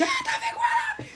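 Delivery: shrieked very high; retold hysterical shriek at the driver; hysterical scream. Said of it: "je commence à devenir hystérique".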